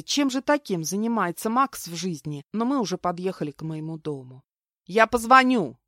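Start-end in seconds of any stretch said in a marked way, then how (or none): 4.9-5.8 louder, higher farewell call; parting shout. A narrator's reading. Friend calling goodbye after dropping her off, shouting. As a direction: call it out as you leave. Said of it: "крикнула на прощание Тина".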